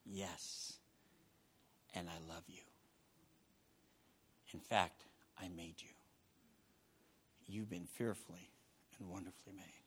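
soft, low reassuring voice; God's tender answer. Acted. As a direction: comfort them gently. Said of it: "And you know what he says".

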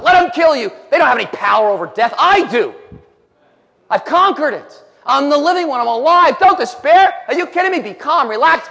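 high, loud preaching; rally the despairing at full voice; triumphant exhortation. Acted.

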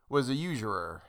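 plain reading voice

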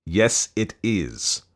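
"Yes, it is" very slow, each word hit separately; forceful syllables. A narrator's reading. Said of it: "hitting each syllable of each word with force".

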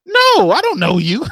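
high, slow, drawn-out no; mock defensive reply. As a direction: act baffled at a stranger using your name. Coworker mocks name-tag familiarity.

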